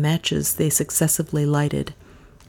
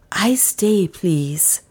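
hushed, wistful plea; 3 semitones higher, much slower than usual. A narrator's reading. Butler asks not to leave, wistful. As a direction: ask wistfully, sinking at the end. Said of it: "said Billy wistfully".

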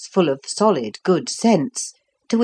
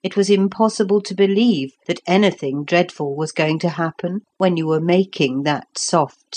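usual speaking voice

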